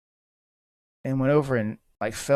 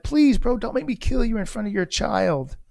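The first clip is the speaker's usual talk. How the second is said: urgent pleading; 8 semitones higher than usual. Retold or imitated. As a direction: beg him urgently, starting high. Acted plea to the armed father.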